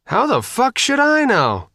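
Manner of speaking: high, strained, desperate outburst. A narrator's reading. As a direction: at her wits' end, cracking high. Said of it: "She sounded desperate".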